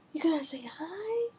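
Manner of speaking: breathy whisper to the cat. Real, not acted.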